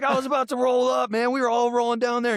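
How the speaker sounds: high-pitched, emphatic protest; imitating dead-serious friend. Rapper explains he nearly drove over, high serious imitation. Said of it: "He was dead serious".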